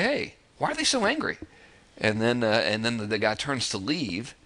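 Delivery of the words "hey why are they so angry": much higher, startled and nosy question; curious neighbor asking. Retold or imitated. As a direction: sound like a neighbor who's suddenly curious. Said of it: "and they say".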